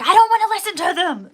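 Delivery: high, loud whiny refusal; stubborn partner. Retold or imitated. Imitating her partner refusing to listen, high whine. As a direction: whine it like a sulky man. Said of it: "He's like".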